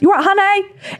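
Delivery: high, sing-song camp "You right, honey?"; camp sweet impression. Mimicking James charming bar staff, high camp sing-song. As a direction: lilt it up sweetly like a camp friend. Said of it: "whenever James speaks to people, it's incredible".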